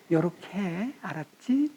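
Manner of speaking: soft, slow murmur; whispering Spirit. Pretend. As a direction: lean close and gently tell them. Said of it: "우리를 속삭이듯이". (성령이 곁에서 속삭이듯 부드럽게)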